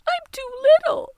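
high stuttering sob; blubbering. Acted. Teeny sobbing that she is too little, high and tearful. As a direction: stammer through tears. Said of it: "Teeny blubbered".

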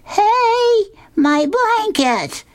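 high-pitched mock scream; a little boy's high cry, drawn out; Linus screaming. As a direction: squeal as your blanket is snatched. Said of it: "And he screams out".

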